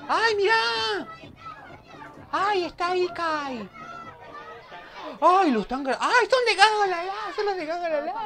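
high, loud thrilled cries; excited shrieks. Real, not acted. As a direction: squeal it out with joy. Excited recognition. (gritos emocionados y agudos)

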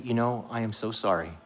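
soft, consoling apology; gentle acted sympathy; calm and compassionate. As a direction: comfort a hurt child softly. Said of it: "you're calm and compassionate".

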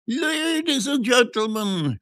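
high, drawn-out quack's shriek; piercing showman's cry. A narrator's reading. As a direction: shriek for the crowd's attention. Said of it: "shrieked the quack".

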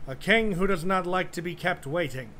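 loud, high royal proclamation; commanding shout. Acted. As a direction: bellow it like a proud young king. Boy king demands respect.

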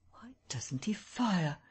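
slow, hushed whisper; voiceless, drawn-out private question; whisper to himself. A narrator's reading. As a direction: whisper it under your breath, puzzled. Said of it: "whispered Kemp to himself".

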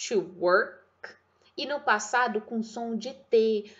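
ordinary talk, nothing marked

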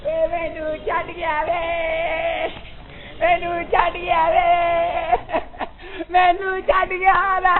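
drawn-out, chant-like funeral lament; mourning wail. Acted. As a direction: wail slowly for the one who left, swelling as it goes. Mourner laments being left behind. (ਵੈਣ ਪਾ ਕੇ ਲੰਮਾ ਵਿਰਲਾਪ)

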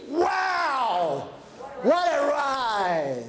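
a slow, drawn-out "Wow, what a ride!" full of wonder; thrilled proclaiming. Shown as a demonstration. Speaker models the crowd's finish-line cry, slow and delighted. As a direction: savor every word like finishing a wild ride. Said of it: "proclaiming loudly".

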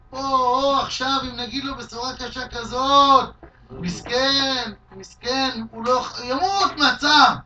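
loud, fretful cry of worry over bad news; worried alarm. Family members fretting over how to break the news, alarmed and loud. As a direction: sound alarmed at what the news will do. Family fearing to tell father.

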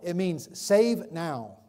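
normal talking voice

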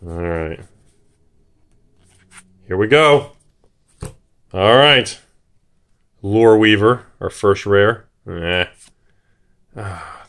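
normal talking voice

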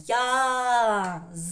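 Я-ЗИК loud, in two stretched syllables; syllable-by-syllable demo. Shown as a demonstration. Teacher leads everyone together, Я-ЗИК in long syllables. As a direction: chant Я, then ЗИК, drawn out. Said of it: "І ще раз".

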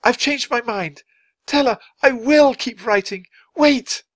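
high, loud, urgent pleading; desperate shout. A narrator's reading. Charles to the fading ghost, shouting. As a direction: shout it before they vanish. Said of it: "Charles shouted to the ghost".

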